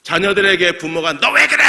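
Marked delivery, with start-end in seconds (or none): 1.2-1.6 sudden loud, high-pitched scolding shout; parent yelling. Acted. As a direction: yell it at your child, far higher and louder. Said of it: "이러고 소리를 지릅니다".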